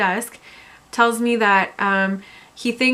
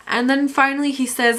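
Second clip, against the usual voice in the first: ordinary delivery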